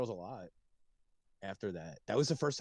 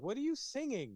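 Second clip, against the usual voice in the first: incredulous; 12 semitones higher than usual. Retold or imitated. Incredulous retold question.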